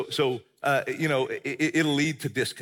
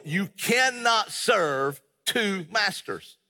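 slow, higher, every word spaced out for emphasis; emphatic; 4 semitones higher, much slower than usual. Real, not acted. Preacher driving the verse home to congregation, emphatic. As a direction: hammer out CANNOT SERVE, then TWO MASTERS, very slowly.

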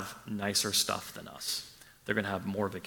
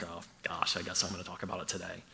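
mock reluctant groan; 5 semitones lower, 10 dB quieter, faster than usual. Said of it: "you kinda like".

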